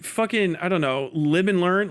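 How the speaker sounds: normal talking voice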